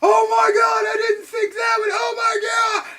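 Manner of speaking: high, loud panicked shouting; mock horror, yelled way up high; retelling a TV guy screaming over his friend; panicked buddy. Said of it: "his buddy was like".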